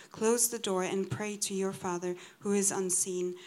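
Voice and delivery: ordinary talk, nothing marked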